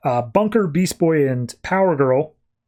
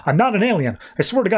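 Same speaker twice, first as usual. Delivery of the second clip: higher-pitched retold protest; insistent denial; 6 semitones higher, faster than usual. Ruth swearing to the detective she's human, insistent denial.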